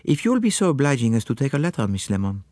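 quick, businesslike dictation; brisk request to his secretary; briskly. A narrator's reading. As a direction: rattle it off briskly. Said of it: "Then he said briskly".

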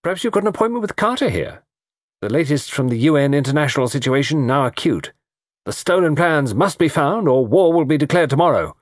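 high, mock-dramatic bulletin; teasing young man. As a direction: melodramatic clowning, pitched well up.

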